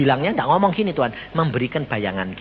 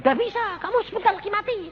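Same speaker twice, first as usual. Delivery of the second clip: mock shout; much faster than usual. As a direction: bark the order, pitched way up. Acted prophet orders sick man.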